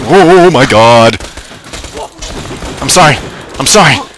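loud startled yelp and apology; a real jolt of surprise, loud; startled shout. Real, not acted. Player startled mid-fight shouts in surprise.

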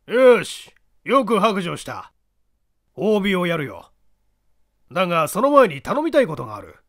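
put-on commanding gruff old man; creaky wise old man. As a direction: order him firmly. Small man orders Kinkou.

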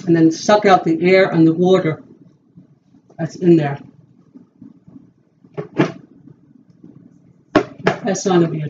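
words muffled by guard on teeth; sucking air through teeth; speaking with mouth guard in. Real, not acted. Seen 0: Hands press a guard onto upper teeth while speaking, sucking air out.

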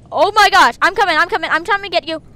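high, loud, excited rush; urgent shouting. Real, not acted. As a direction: call out while racing to help. Rushing to a teammate.

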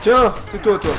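loud, high taunting yell; taunting shout. Real, not acted. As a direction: shout insults at the enemy. Gamer taunts enemies while fighting.

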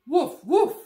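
loud playful puppy woofs; puppy barks. Pretend. Being Biscuit the puppy for the kids, loud playful woofs. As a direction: bark like a happy little dog. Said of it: "you guys can all be Biscuit and say".

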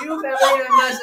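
laughing through the words, pitch jumping up. Real, not acted. Host teased by co-host, laughing high. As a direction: giggle it out after being teased.

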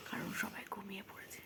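whispering. Real, not acted. Greeting viewers at night while everyone sleeps, whispering. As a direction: hush it so nobody wakes. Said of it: "এখানে আস্তে আস্তে কথা বলছি".

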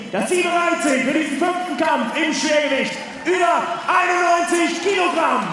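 hype shout. Real, not acted. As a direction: fire the crowd up. Announcer hypes crowd.

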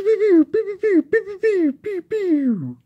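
very high rapid-fire pews; laser pew sounds. Pretend. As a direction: squeal a volley of laser shots, falling at the end. Imitating the toy's gunfire.

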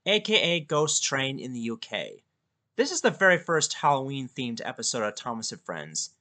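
ordinary delivery

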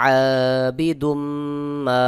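আবিদুম মা with a long hummed ম joining the words; joined mim. Shown as a demonstration. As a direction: chant আবিদুম into মা on one held m. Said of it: "মিমকে যোগ করে পড়তে হবে". (আবিদুম মা, মিম যুক্ত করে গুন্নাসহ)

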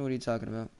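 normal talking voice

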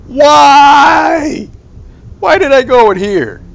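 high, loud panicked yell; panicked shout. Real, not acted. Trapped with madman.

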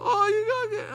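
high sobbing plea; crying daughter. Retold or imitated. Daughter sobbing over her father's cancer, high and tearful. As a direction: let the voice break into crying. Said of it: "What you so upset about".

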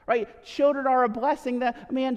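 normal talking voice

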